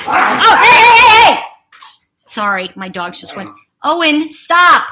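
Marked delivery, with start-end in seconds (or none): 0.1-1.4 loud, high shout to stop them; sharp real yell at fighting dogs. Real, not acted. 3.8-4.9 louder, slow stern yell; stern yell at the dog; scolding shout. Real, not acted.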